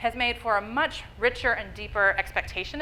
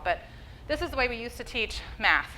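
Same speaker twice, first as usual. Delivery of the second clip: normal talking voice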